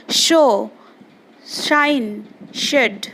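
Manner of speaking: शो, शाइन, शेड each begun with hushing SH; SH sound demonstration. Shown as a demonstration. Teacher reading for pupils to copy, clear SH in शो, शाइन, शेड. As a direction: model a distinct SH on शो, शाइन, शेड. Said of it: "S H में हम लोग कैसे pronounce करेंगे".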